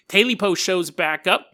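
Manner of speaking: ordinary delivery, nothing marked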